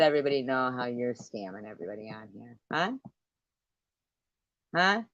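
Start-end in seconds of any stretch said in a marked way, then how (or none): none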